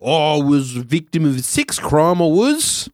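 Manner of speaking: hammed-up Australian accent; broad comic Australian accent; Hannah Gadsby. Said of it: "take anyone with an Australian accent".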